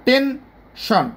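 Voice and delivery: "Tension" slowly split, ten, shon; two-beat syllables. Shown as a demonstration. Teacher asking learners to notice, "tension" slowly in two beats. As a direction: break "tension" into two separate pushes. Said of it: "দুইটা ধাক্কায় এটা উচ্চারিত হয়েছে".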